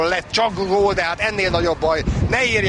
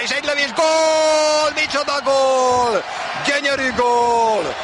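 building to a slow roaring goal call; rising excitement into a long shout; excited hungarian sports commentary; 5 semitones higher, slower than usual. Real, not acted. Goal scored in live commentary. (fokozódó, üvöltő gólkiáltás)

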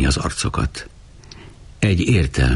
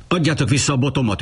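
magasan, gyorsan üvöltve; üvöltés; 11 semitones higher, much faster than usual. Acted. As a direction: Uri dühösen visszaköveteli a botját. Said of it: "üvöltötte". (gyors, magas hangú üvöltés)

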